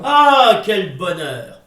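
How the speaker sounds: overjoyed exclamation; delighted cry. Acted. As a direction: burst out with relief. Joyful laugh of relief.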